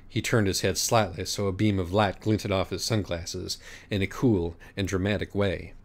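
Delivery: plain reading voice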